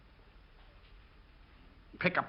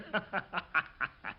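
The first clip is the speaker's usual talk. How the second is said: real laugh; 5 semitones higher, 5 dB quieter than usual. Real, not acted. Laughs after Zanuck's retort.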